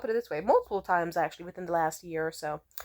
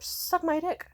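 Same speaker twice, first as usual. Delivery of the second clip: defiant; 10 semitones higher, slower than usual. Real, not acted. Dismissing the ex.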